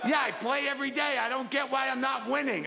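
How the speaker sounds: mock dad shouting about the lottery; dad's high complaint. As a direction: play the baffled dad yelling his logic. Dad voiced in story.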